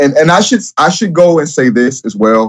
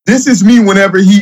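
ordinary talk, nothing marked

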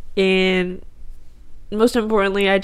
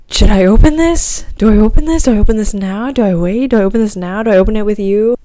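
louder, urgent burst of repeated questions; breathless-with-anticipation pleading, turned up loud; frantic questioning; 8 dB louder, faster than usual. Retold or imitated. Daughter asking mom whether to open the email, frantic.

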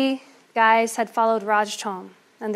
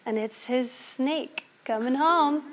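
ordinary delivery, nothing marked

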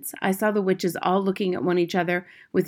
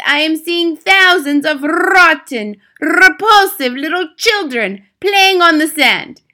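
put-on high, loud accented tirade with rolled Rs; screaming Grand High Witch; 10 semitones higher, 13 dB louder than usual. A narrator's reading. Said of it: "she screamed".

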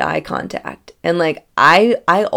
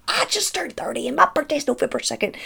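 excited; 10 semitones higher, 4 dB quieter, faster than usual. Retold or imitated. Revealing shared birthday.

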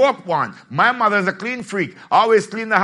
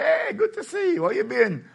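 mock greeting; 6 semitones higher, 3 dB quieter than usual. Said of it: "I say".

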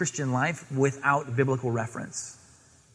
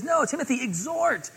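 higher, pleading exhortation; earnest higher urging; Paul urging Timothy; 9 semitones higher than usual. Acted. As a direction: encourage him like a mentor. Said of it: "No, he says".

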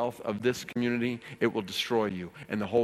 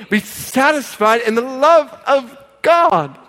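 high, loud cries with drawn-out key words; full-force preaching; 14 semitones higher, 13 dB louder, slower than usual. Real, not acted. Pastor relays Paul's call to live for gain, full-force preaching. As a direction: exhort the congregation at the top of your voice.

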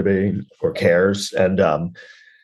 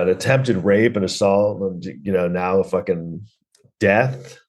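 ordinary delivery

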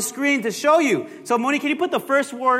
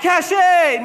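high, louder mock shout of a wrong answer; imitated shout-out; 7 semitones higher, 4 dB louder than usual. Pastor mimicking someone's answer from the congregation, mock shout. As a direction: blurt it out high, ending on "shay". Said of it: "someone's like".

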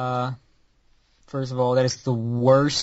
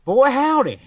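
higher, louder Southern howdy; 9 semitones higher, 4 dB louder than usual. Acted. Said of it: "possessed by my redneck ancestors".

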